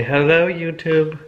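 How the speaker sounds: put-on slow, sing-song prompt for a toddler; coaching a toddler. Shown as a demonstration. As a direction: coax the baby to say it.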